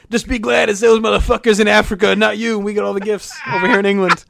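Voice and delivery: sneering put-on British voice; mock British accent. Host parodying the charity lyric in a put-on British accent. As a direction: play a smug English singer. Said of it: "they couldn't just say".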